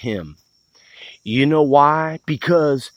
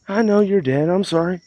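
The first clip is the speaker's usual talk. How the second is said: normal talking voice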